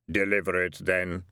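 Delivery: low, hollow voice of Death; Death's voice. A narrator's reading. Death coolly telling the dead man to deliver, low and hollow. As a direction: speak as Death, deep and unhurried.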